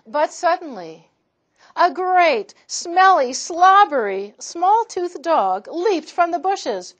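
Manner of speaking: high, loud storytelling burst; loud and thrilling; dramatic build. A narrator's reading. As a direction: announce the dog with big excitement.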